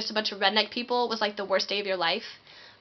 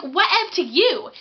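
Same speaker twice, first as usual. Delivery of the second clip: higher, louder huffy comeback; much higher, louder indignant whatever; offended retort; 7 semitones higher, 5 dB louder than usual. Retold or imitated. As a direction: throw it back at them, affronted.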